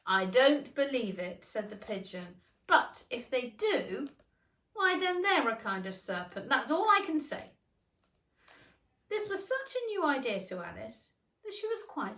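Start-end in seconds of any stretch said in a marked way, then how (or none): 0.1-7.5 stubborn pigeon. A narrator's reading.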